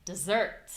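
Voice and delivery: "dessert" stressed on SERT; quick "de", strong "ssert"; weight on the end of "dessert"; second-syllable stress. Shown as a demonstration. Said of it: "only difference really is the stress".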